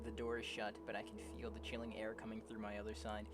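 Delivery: low, soft, measured reading; story narration. Acted. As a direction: read the tale quietly and evenly. Reads game story aloud.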